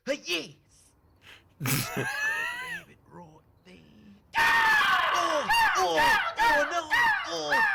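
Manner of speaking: high shrieking yells; screaming in joy then panic; excited screaming. Acted. Ticket lost again.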